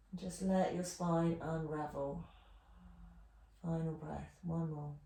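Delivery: soft, calm relaxation voice; guided relaxation. Acted. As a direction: ease them gently into rest. Soft guided relaxation at end of class.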